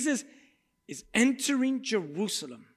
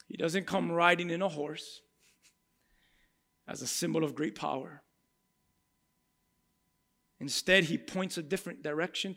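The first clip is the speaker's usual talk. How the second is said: usual speaking voice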